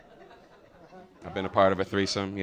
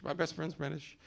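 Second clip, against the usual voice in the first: quiet aside; 6 semitones higher, 10 dB quieter than usual. Real, not acted. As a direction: repeat "My best friend's British." much softer, as an aside. Repeating the confession under his breath.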